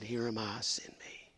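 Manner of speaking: soft, breathy whispered surrender; hushed whisper. Acted. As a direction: let it fall to a whisper, almost a prayer. Quoting Isaiah, hushed.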